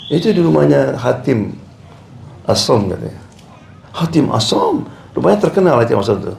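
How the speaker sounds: ordinary talk, nothing marked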